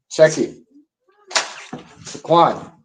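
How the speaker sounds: loud, high call across the room. Real, not acted. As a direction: shout for Quan's attention. Calling out to someone off mic.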